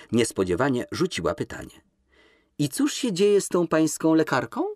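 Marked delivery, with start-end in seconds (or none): 2.6-4.6 light tone. Acted. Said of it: "Powiedziała to lekkim tonem".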